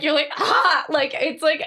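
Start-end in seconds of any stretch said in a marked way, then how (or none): 0.4-0.8 high, loud, short cry; mock pained scream. Acting out a night cramp, mock scream. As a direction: let out an involuntary yelp, acted. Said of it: "I hate that scream".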